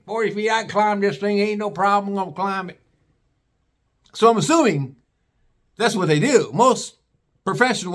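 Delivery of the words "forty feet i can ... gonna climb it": higher-pitched backwoods hillbilly voice; hillbilly tree climber. Acted. Hillbilly dad announces he will climb the tree, cocky hillbilly voice. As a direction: play a hillbilly tree cutter bragging. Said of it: "not these hillbillies".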